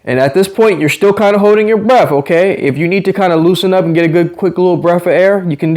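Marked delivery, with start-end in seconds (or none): none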